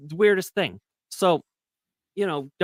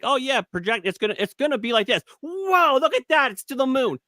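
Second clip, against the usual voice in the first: rising higher and higher into a mock-amazed whoop; hyped projection; 11 semitones higher than usual. Mimicking Social Blade's forecast for Chris's channel, mock hype. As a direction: hype it up like the chart is rocketing. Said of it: "it's like".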